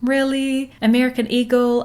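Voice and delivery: skeptical. Retold or imitated. Vlogger recalling doubt about the headlines, skeptical. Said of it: "I was skeptical".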